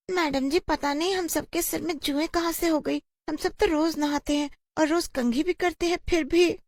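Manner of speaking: put-on high child voice; plaintive pupil. A pupil tells madam they all bathe daily, high and plaintive. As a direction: whine the puzzled excuse up high.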